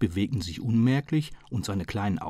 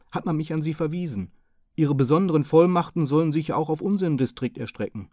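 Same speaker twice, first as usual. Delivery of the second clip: higher, louder, politely apologetic Hearson; bow and beg pardon with a raised voice; polite apologetic gentleman; 6 semitones higher, 4 dB louder than usual. A narrator's reading. Hearson apologising to the chief inspector, higher and politely. Hearson explains his errand.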